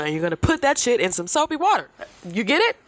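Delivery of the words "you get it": usual speaking voice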